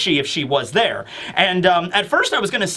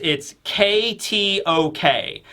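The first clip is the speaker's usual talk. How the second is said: usual speaking voice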